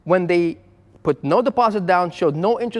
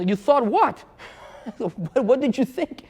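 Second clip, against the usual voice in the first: higher, slow, mock-disbelieving; incredulous retort; 6 semitones higher, slower than usual. Dealer retelling his comeback to a flustered caller, incredulous. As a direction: needle the caller, slowly and higher.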